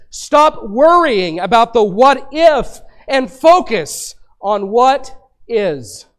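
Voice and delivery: slow each word right down and lean on "what if" and "what is"; slow, deliberate emphasis. Shown as a demonstration. Pastor spelling out the key phrase to his congregation, slow and emphatic. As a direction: spell it out slowly so it sinks in. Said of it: "I'll say it th-, like this".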